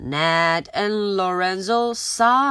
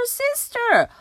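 much higher, slow pleading; worried plea. Acted. Younger sister begging her sister to be careful.

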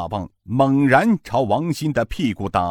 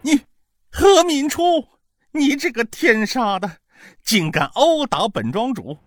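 much higher, cursing through cries of pain; howling curses; 13 semitones higher than usual. A narrator's reading. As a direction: yelp each curse between beatings. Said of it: "他一面嚎叫，一面骂道".

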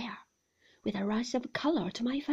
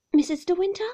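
high rise on De, asking "Missus De Winter?"; questioning; 8 semitones higher, 11 dB louder, faster than usual. A narrator's reading. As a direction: stress De, lift the question. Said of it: "low and rather harsh".

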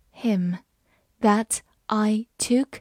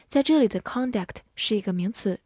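ordinary talk, nothing marked

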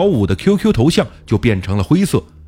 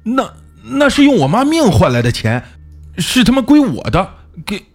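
higher-pitched, stammering angry retort; cursing back; 5 semitones higher than usual. A narrator's reading. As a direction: snap back, stumbling over the first words in anger. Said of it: "小五骂道".

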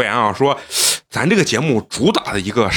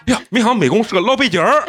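put-on Beijing accent; 7 semitones higher than usual. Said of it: "他说".

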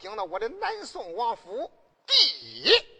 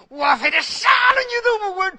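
high, loud shouted threat; loud enraged vow; furious threat; 10 semitones higher, 10 dB louder than usual. Acted. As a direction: bellow the threat in rage. Character threatens to kill.

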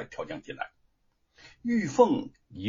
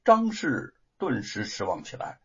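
plain reading voice

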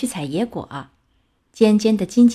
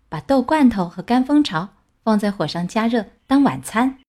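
plain reading voice

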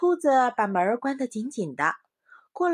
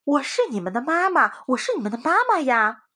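louder anxious pleading; impatient insistence; 5 semitones higher, 6 dB louder than usual. A narrator's reading. Wolf insists to the rabbits he is their mother, loud. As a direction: press the lie urgently. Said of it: "大灰狼着急了".

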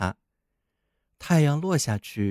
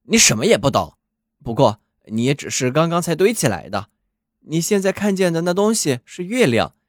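put-on loud, hoarse old watchdog; a grumpy dog lecturing the snowman; 4 dB louder than usual. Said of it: "它有点沙哑".

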